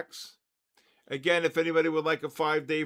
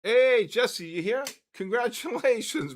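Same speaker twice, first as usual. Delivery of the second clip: higher, louder hey to the winner; excited greeting; 7 semitones higher, 5 dB louder than usual. Real, not acted. Host greeting the winner as he walks up, excited call. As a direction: hail him as he walks up.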